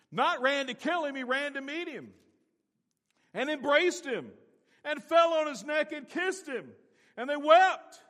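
high, loud preacher's climax; soaring pulpit fervor; impassioned preaching. Real, not acted. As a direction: lift it up and proclaim.

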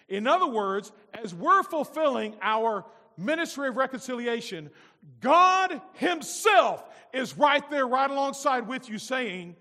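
loud, rising preacher's call; full-force preaching. Acted. Preacher stirring the church about God's message, loud and rising. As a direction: build it up and shout the praise.

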